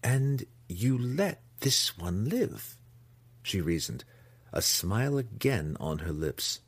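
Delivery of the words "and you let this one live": slow, drawn-out matron, pitched higher; scheming matron. A narrator's reading. Matron reasons with her son, slow and drawn out. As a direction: reason it out slowly and deliberately.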